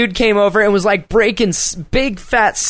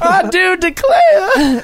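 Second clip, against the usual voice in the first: very high, slow southern drawl; mock southern belle; 11 semitones higher, much slower than usual. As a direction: squeal it in a drawn-out southern caricature.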